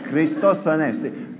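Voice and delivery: preacher shows the congregation a proper Greek accent. Shown as a demonstration. Pastor demonstrating Greek pronunciation with a Greek accent. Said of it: "Now say it with a Greek accent".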